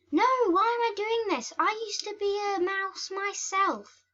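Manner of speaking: high-pitched, hurried self-protest; mock dismay. Cat's conscience protests in role.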